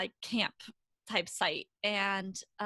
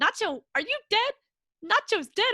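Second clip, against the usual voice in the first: high, loud, falling calls of a name; calling the cat with rising panic; imitated distraught owner; 12 semitones higher, 8 dB louder than usual. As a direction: owner bending down to his cat. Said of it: "and he's like".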